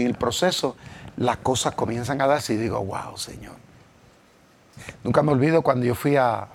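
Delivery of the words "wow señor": breathy whispered wow to the Lord; hushed, amazed whisper to God; awed whisper. Retold or imitated. Awed aside to God in story.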